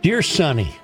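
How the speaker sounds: usual speaking voice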